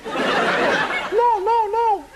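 a high little pig squeal of no; high-pitched pig refusal; squeaky pig no. Acted. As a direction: refuse in a tiny high piggy voice.

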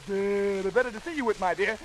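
put-on low, sly wolf answering the girl; disguised storybook wolf. A narrator's reading. Wolf poses as Grandma to Red.

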